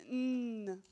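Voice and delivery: "n" as a long nasal hum; isolated phoneme. Shown as a demonstration. Teacher showing where it resonates, "n" hummed long. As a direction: sustain "n" resonating in the nose, not the letter name. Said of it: "ça montre le lieu où ça résonne".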